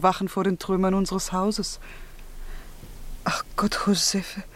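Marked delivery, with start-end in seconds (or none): 3.2-4.4 soft whisper, shaken; whispered lament. Acted. To Josefe about the ruins, a shaken whisper. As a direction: breathe it out in disbelief. Lament over ruined house.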